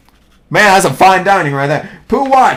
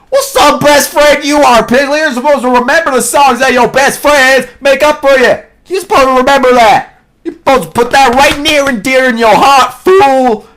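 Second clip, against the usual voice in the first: high, loud indignant rant; hammed-up outraged telling-off; mock scolding; 5 semitones higher, 3 dB louder than usual. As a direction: lay into your friend, hurt and indignant.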